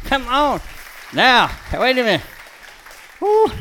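high, loud, urgent calling to the dog; pleading at full pitch; excited urging. Real, not acted. Trainer urging his dog past the squeaky toy, high and loud.

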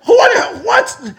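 high, loud, sputtering disbelief; mad sputter. Retold or imitated. Accused man lost for words at the buyer, mad. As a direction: start to yell and stumble over it. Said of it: "I was mad".

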